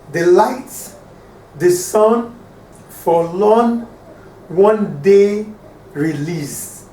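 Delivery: "The light, the sun, forlorn, one day release" in separate pairs, a pause between each; broken up for counting. Shown as a demonstration. Teacher showing ten syllables, "The light, the sun, forlorn, one day release" in paused pairs. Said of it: "If we count".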